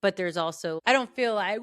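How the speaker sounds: ordinary delivery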